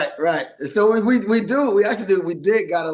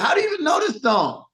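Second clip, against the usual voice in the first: surprised; 12 semitones higher than usual. Retold or imitated. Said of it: "And I was like".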